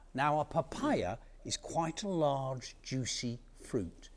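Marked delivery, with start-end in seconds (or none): none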